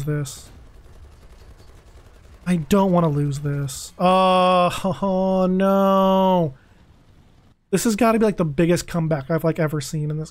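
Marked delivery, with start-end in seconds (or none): 4.0-6.5 long drawn-out groaning "oh no"; dismayed wail. Real, not acted. Gamer watching the match slip away, dismayed wail. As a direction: moan it out as defeat sinks in. Realizing the game is being lost.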